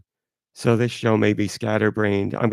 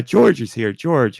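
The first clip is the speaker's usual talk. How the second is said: ordinary delivery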